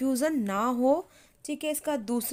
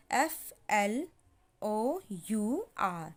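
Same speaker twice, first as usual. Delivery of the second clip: slow soft dictation spelling; 4 semitones lower, 5 dB quieter, much slower than usual. Shown as a demonstration. Spelling out letters.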